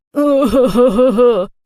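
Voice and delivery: loud jeering laugh at her; mocking laugh. A narrator's reading. Bully mocking girl with her diary, loud jeering laugh. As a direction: burst out laughing in her face.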